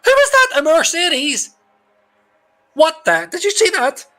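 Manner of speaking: high, loud shocked disbelief; real startled outburst. Real, not acted. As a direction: react as if you just saw a crash live. Shocked reaction to a crash.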